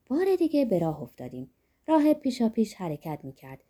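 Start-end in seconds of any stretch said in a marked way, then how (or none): none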